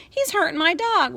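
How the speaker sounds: put-on high, loud little girl squeal. Little sister squealing to mother about her brother. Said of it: "Lori squealed".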